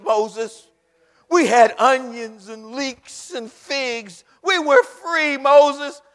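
loud complaining shout at Moses; the people shouting complaints at Moses; whining Israelites. Acted. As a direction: yell the grievance like an ungrateful crowd.

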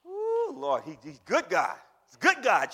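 high, loud, ringing preacher's call; preacher shouting that anybody can be saved; full-force preaching. Acted. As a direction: hammer home whosoever to the church. Preaching exclamation.

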